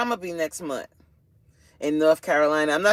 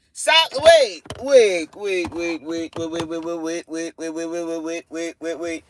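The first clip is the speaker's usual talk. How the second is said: loud stop trailing into repeated waits; mock disbelief; 3 dB louder than usual. Real, not acted. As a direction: halt everything, then keep stuttering wait. Halting chat in mock disbelief.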